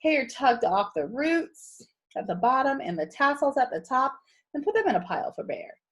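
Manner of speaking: ordinary delivery, nothing marked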